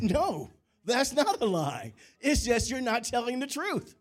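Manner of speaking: retold protest, pitched well up; mock insistence. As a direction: argue the hair-splitting excuse with mock conviction. Acts out his own sly denial.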